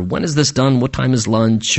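low, uninterested mock aside; bored listener. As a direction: mumble like a churchgoer waiting for it to end. Imagined impatient listener wanting lunch.

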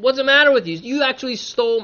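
high, indignant outburst, lightly acted; angry Laban. Father-in-law accusing Jacob of theft, acted anger. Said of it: "Laban is angrily confronting".

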